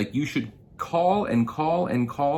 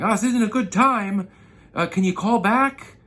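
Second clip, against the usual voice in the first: high, loud mock brush-off; hammed-up annoyed customer; dismissive; 12 semitones higher, 7 dB louder than usual. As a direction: imitate a rude guy putting you off. Retold customer brushing him off.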